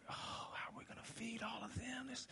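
unvoiced fretting whisper; worried whisper. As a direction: whisper the worry to yourself. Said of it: "and he's like".

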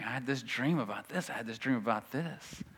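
soft, offhand mimicry; mock dream-talkers. False teachers going on about their dreams, softly mimicked. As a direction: chatter it under your voice, mocking. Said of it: "keep talking about their dreams".

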